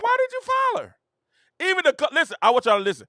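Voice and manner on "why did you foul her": high mock teammate asking why; teammate's disbelief. Voicing the teammate asking why she fouled, high mock disbelief. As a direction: squeak it like a stunned teammate. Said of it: "She's like".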